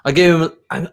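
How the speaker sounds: normal talking voice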